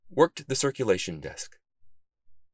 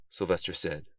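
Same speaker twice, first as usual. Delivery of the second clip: ordinary delivery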